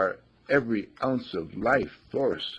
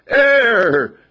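high, loud cry for air; gasped shout; 14 semitones higher, 13 dB louder than usual. Acted. Young man answering the master after near-drowning, a high loud cry. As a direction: shout it like you just came up from water.

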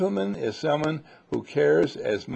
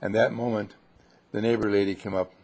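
usual speaking voice

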